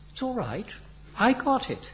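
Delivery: light, high-pitched nonchalance; casual. A narrator's reading. Henry owns up to the downed plane, casual. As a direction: toss it off casually. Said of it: "said Henry casually".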